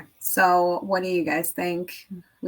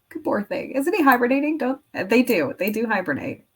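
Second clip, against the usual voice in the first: normal talking voice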